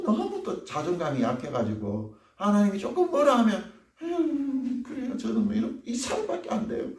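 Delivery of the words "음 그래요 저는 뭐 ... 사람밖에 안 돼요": high, soft, whimpering helplessness; whiny self-pity. Acted. Timid believer collapsing at God's slightest rebuke, whiny. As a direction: whimper like you can do nothing.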